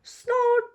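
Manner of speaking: very high little snort; piggy snort. A narrator's reading. Excited little piglet snorting before the ducks. Joe jumping up and down.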